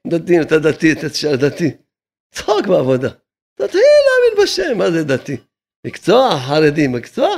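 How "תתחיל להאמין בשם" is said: high mocking voice. Rabbi scoffing at those arguing over two hundred shekels, high and mocking. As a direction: jump way up into a high, incredulous mocking pitch.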